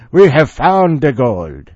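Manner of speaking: put-on loud, slow Irish accent. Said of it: "that sounds Irish now".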